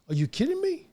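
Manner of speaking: high, disbelieving agent voice; incredulous agent on the phone; surprised agent. Retold or imitated. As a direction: shoot the pitch way up in shock. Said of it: "she said".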